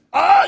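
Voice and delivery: loud, high startled "ah"; startled cry. Retold or imitated. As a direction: yelp as if grabbed by the collar. Cry when grabbed by collar.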